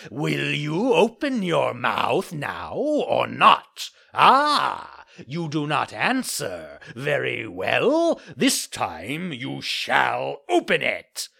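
loud, slow villain voice; menacing threat. Acted. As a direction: threaten him slowly, relishing it. Assassins threaten the silent puppet.

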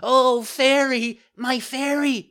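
very high, loud pleading puppet; panicked cry. A narrator's reading. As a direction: cry out desperately for help. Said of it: "the marionette cried out".

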